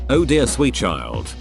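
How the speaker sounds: usual speaking voice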